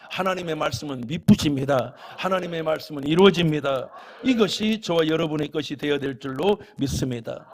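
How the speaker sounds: fiery preacher building to a blessing; preaching at full force. Acted. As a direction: thunder it from the pulpit. Proclaiming the word is faithful.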